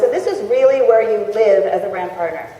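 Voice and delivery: normal talking voice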